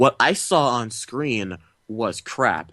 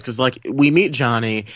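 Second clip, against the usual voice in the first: ordinary talk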